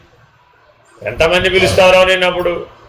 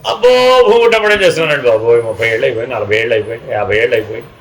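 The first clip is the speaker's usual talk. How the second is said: boastful seeker; 7 semitones lower, faster than usual. Retold or imitated. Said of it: "ఏమంటే, ఎంతకాలం సాధన చేస్తున్నారండి?".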